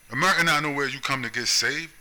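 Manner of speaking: normal talking voice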